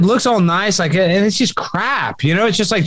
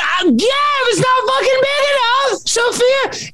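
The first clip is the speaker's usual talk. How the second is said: very high, yelling retort; high shouted retort over pan size; retold shout; slower than usual. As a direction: yell it back at her in frustration. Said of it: "I'm like".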